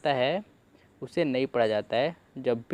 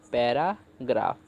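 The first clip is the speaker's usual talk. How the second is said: "Paragraph" in two chunks, para, pause, graph; split into parts; 2 semitones lower, slower than usual. Shown as a demonstration. Teacher assembling the word for learners, "paragraph" said in separate parts. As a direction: break "paragraph" apart before graph. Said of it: "तो बन गया आपका".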